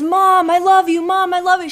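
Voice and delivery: calling to Mom. Retold or imitated. Daughter to a mother who doesn't respond, calling out. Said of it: "maybe she doesn't hear. She's far away".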